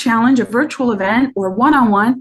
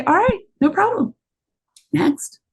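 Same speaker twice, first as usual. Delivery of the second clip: breezy shrug; 5 semitones higher, 3 dB quieter than usual. Shown as a demonstration. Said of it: "Or just say".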